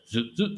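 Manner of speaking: high-pitched mock robot "zoop"; robotic zip noise. Son mocking dad's posture as an AI move, high zoop sound. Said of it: "his impersonation of me".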